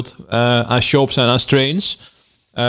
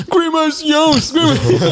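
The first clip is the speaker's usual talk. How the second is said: excited shout; slower than usual. Retold or imitated. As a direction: call the name across the street. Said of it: "die postbezorger komt naar je toe".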